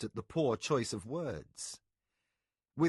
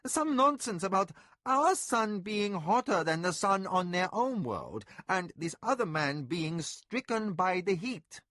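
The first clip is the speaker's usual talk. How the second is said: higher, louder dismissive noblewoman; scornful mistress; 8 semitones higher, 3 dB louder than usual. A narrator's reading. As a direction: brush it off as nonsense. Mistress voice held.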